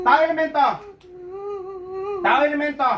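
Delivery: forceful exorcist's shout; shouted interrogation. Real, not acted. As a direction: fire it at the spirit as a challenge. Healer interrogates the spirit.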